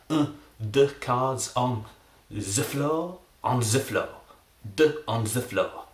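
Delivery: mock French accent on every word. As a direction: lay on an attempted French accent. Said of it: "I will be doing a French accent".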